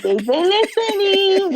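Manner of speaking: host's influence noticed, slow and pleased; knowing delight. Retold or imitated. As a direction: savour it with a knowing grin. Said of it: "I'm like".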